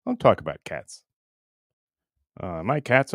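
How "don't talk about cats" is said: normal talking voice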